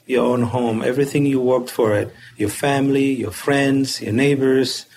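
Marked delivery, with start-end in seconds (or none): none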